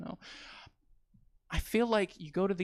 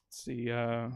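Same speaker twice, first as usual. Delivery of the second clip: ordinary delivery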